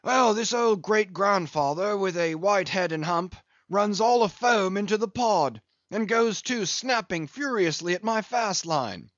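put-on a rueful old English whaler telling his loss; a hint of a weathered English captain; Englishman captain. Englishman's tale.

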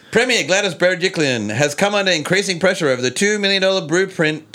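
high, bright news-anchor delivery; reporter voice. Pretend. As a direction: read it like a TV reporter. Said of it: "reporter voice".

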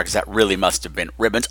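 ordinary talk, nothing marked

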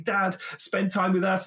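put-on high, pleading little-kid voice; mock child asking dad to play; imitating his kid. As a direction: whine it like a child tugging a sleeve. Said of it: "pulling up my sleeve saying".

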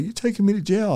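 very high-pitched mock wail; mock crying. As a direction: squeal it like a blubbering suspect. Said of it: "he's crying now".

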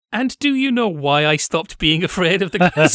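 ordinary delivery

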